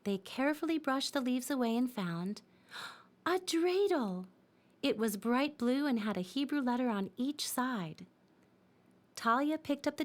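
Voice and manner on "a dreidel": surprised gasp. A narrator's reading. Discovery of a hidden toy.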